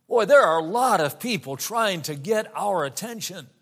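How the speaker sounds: usual speaking voice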